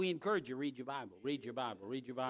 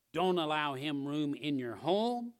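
ordinary talk, nothing marked

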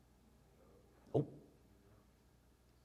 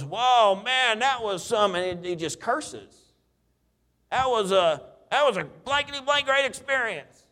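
excited buddy; 6 semitones higher than usual. Retold or imitated. Buddy voiced after getting saved.

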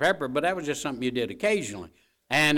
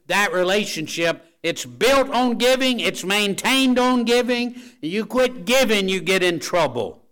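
rising sermon peak; preaching at full force; 6 semitones higher, 6 dB louder than usual. Acted. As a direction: hammer it home from the pulpit.

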